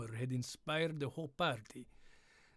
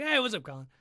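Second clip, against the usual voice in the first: a Sean Connery brogue with a Russian twist, louder and higher; Scottish Russian accent; 6 semitones higher, 6 dB louder, much faster than usual. Retold or imitated. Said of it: "Scottish Russian".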